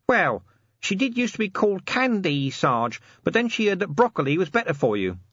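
lightly voiced corporal nudging his sergeant; Nobby, cheeky corporal. A narrator's reading.

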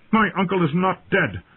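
high, indignant denial; angry. A narrator's reading. Robb to Yoren about his uncle, angry. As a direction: contradict hotly, pitched right up. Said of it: "Robb Stark said loudly, anger in his tones".

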